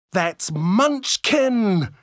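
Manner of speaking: high, slow excited cry; exclaiming. A narrator's reading. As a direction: call it out in surprise. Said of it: "Sophie exclaimed".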